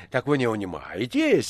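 plain reading voice

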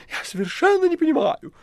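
high, indignant cat voice; offended cat. Acted. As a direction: act hurt and innocent. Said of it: "говорил он сверху".

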